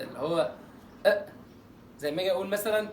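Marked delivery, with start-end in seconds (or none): 1.1-1.3 "أ" as an abrupt catch in the throat; a short hamza, "أ"; glottal stop. Shown as a demonstration. Teacher demonstrating the hiccup T, glottal stop. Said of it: "بتنطق زي الهمزه كده".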